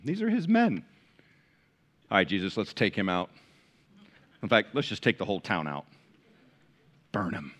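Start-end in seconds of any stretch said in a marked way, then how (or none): 2.1-7.5 lower, softer mock eagerness to burn the town; a hint of hotheaded henchmen; eager disciples. Disciples voiced asking to destroy.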